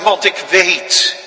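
loud, high proclamation of faith; great loud voice. Acted. Stefanus crying out his faith as he dies, loud and high. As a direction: proclaim it like a dying martyr. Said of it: "En dat geeft hem die grote stem".

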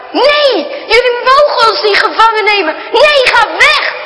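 put-on high, loud, desperate boy's shouts; boy shouting at a stranger not to take them; boy yelling in fear. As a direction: fight them off with your voice. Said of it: "hij roept".

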